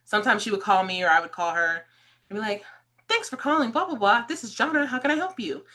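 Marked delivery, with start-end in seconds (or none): 3.1-5.6 high, polite phone-greeting singsong; customer service voice. Retold or imitated. Answering the clothing store phone, customer service voice. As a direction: recite the store greeting like a helpful clerk. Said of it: "Jana's customer service voice".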